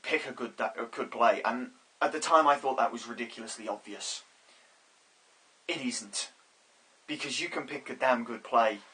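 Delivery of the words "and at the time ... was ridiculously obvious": ordinary talk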